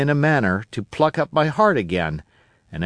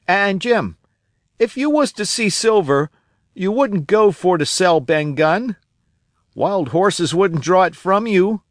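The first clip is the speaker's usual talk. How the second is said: put-on a higher, eager old sailor voice; old castaway Ben Gunn; 5 semitones higher than usual. Old castaway telling Jim about Flint, eager and higher. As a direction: spin the tale of Flint like a wild old castaway.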